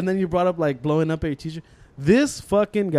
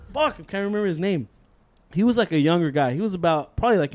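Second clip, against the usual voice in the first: ordinary talk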